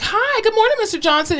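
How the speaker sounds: high, sing-song hello; cheery mock greeting. Therapist entering patient's room, put-on cheeriness.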